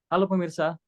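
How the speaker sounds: anchor greeting pitched high. Shown as a demonstration. Coach repeats the tone to avoid, high-pitched. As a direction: lift the whole greeting well above normal speech. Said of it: "Coba jangan pakai nada tinggi".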